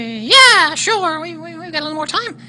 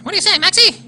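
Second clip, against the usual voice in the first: normal talking voice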